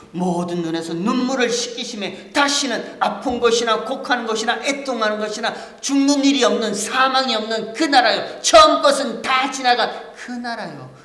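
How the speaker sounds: loud, high, impassioned preaching; full-force preaching. Acted. Pastor preaching to the congregation, loud and impassioned. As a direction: drive the point home to the congregation.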